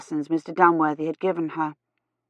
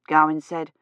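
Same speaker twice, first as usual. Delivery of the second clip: ordinary delivery, nothing marked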